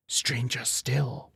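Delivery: soft, slow whisper. A narrator's reading. His inner voice whispers so the crone can't hear. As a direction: hush it as if she might overhear. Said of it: "whispered the voice in his head".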